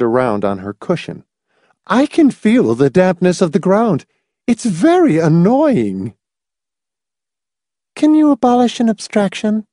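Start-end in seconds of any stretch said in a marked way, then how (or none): 1.9-6.2 Mrs Pritchett complains of the damp ground; complaining old lady. A narrator's reading. 7.9-9.7 high, sharp inquiry; inquiring. A narrator's reading. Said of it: "Miss Rice inquired".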